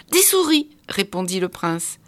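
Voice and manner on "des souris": eager answer. A narrator's reading.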